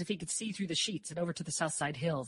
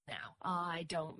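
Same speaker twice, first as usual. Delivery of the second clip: soft, slow, halting objection; hesitant beginning; 11 dB quieter, much slower than usual. A narrator's reading. Mary begins to object to Dor's idea, hesitant. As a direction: start to object under your breath. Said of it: "Mary began".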